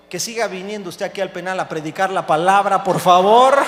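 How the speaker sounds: building from normal to a loud finish; emphatic plea. Acted. Director asks pastor to keep preaching, emphatic plea. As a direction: swell into an insistent, booming request.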